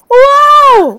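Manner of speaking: excited shout. Real, not acted. Thrilled at family dinner.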